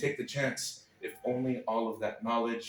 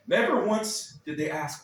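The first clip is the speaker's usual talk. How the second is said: ordinary talk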